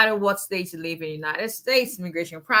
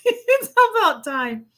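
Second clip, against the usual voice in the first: laughing; 11 semitones higher, 6 dB louder, slower than usual. Real, not acted. Laughing about son turning teen.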